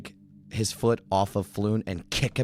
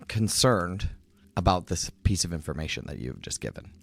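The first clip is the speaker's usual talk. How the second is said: usual speaking voice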